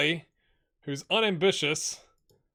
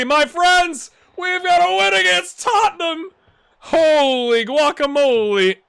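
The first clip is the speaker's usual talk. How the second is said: much higher, louder, exultant announcement; triumphant celebration; 12 semitones higher, 10 dB louder than usual. Real, not acted. YouTube viewers hear him celebrate the win, loudly and higher. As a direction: crow it out to the viewers.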